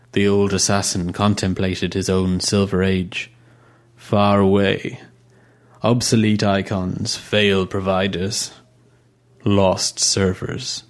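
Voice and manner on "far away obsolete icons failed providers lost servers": slow mournful. Acted. Old assassin mourning his past.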